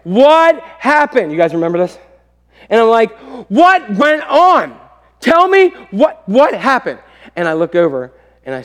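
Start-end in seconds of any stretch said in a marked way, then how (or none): 0.0-1.3 slow, loud, high-pitched angry demand; getting madder. Retold or imitated. Dad demanding answers from his family, getting madder. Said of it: "Now I'm getting madder". 3.5-7.0 slow, loud acted demand; getting madder. Dad pressing family for the news, getting madder. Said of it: "Now I'm getting madder".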